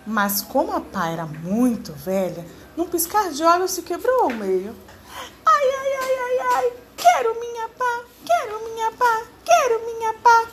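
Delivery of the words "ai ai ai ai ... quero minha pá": very high, loud whining monkey cry; hammed-up squeaky monkey wail. A narrator's reading. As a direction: sob like a small monkey who lost everything. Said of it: "bradou o macaco gemendo e chorando".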